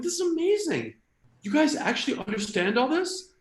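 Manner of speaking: high-pitched wide-eyed excitement; amazed. Acted. To an Israeli friend at dinner, amazed.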